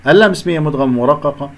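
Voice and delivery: ordinary delivery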